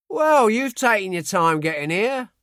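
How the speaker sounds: Cockney sergeant. A narrator's reading. Sergeant ribbing the late American, light Cockney.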